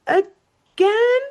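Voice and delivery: sudden high startled squeal; the word shoots up into a frightened yelp; startled shriek. Retold or imitated. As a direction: jump out of your skin on the last word. Jumps back at a ghost.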